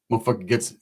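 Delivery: ordinary talk, nothing marked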